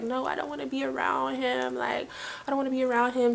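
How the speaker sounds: quietly pleading, barely voiced above a murmur; hushed refusal. Telling the homeboys she won't sleep near him, hushed and tearful.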